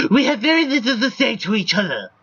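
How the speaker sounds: high, wobbly put-on character voice; exaggerated high cartoonish character; Rexford's character voice. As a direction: do a silly, high-pitched voice for him. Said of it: "I had to do the voice for him".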